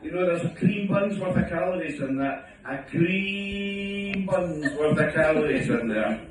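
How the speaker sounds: mock wife lecturing about calories; sing-song nagging wife. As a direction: drag it out in a mocking sing-song. Wife's nagging continues, sing-song.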